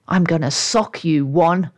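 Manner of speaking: ordinary delivery, nothing marked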